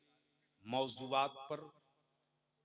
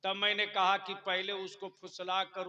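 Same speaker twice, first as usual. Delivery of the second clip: booming political rally speech; 7 semitones higher, 4 dB louder than usual. Real, not acted. Raised preaching voice.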